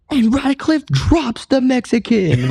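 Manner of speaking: high, stretched-out ringside call; fight announcer. Retold or imitated. Boxer imitating the announcer of his own fight, drawn-out high call. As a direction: imitate an announcer calling a knockdown, slow and high. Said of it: "the announcer was like".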